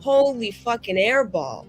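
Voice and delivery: usual speaking voice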